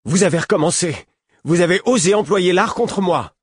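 high, loud cry of disbelief; stunned outrage. A narrator's reading. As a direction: protest in shock and anger. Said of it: "fit d'un ton éberlué". (ton éberlué, aigu et fort)

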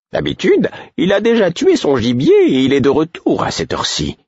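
put-on a worried old woman covering her slip; voiced old woman Caudron. A narrator's reading.

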